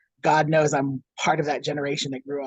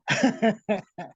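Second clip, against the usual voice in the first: higher-pitched chuckle; laugh at own joke; 6 semitones higher than usual. Real, not acted. Guest laughs at own chocolate joke.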